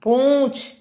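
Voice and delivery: पूंछ with a nasal ऊँ; nasal oo in poonch. Shown as a demonstration. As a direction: let पूंछ hum through the nose. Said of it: "ऊ, ऊ". (पूंछ में ऊँ नाक से)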